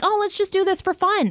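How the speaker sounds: airy high carefree quote; carefree mock voice. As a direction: toss it off like it's nothing. Carefree voice of posters.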